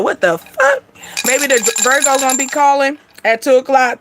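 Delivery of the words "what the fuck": sudden high shocked yelp; jolted awake at two in the morning, shocked; startled awake. Retold or imitated. Woken at two in the morning by a caller, shocked yelp. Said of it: "wake up at two o'clock in the morning and be like".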